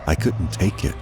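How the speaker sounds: ordinary delivery, nothing marked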